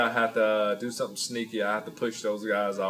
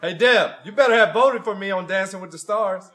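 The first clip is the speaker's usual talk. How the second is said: loud, higher playful shout to the wife; calling out to Deb; 9 semitones higher, 8 dB louder than usual. Acted. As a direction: shout across the room with a teasing grin.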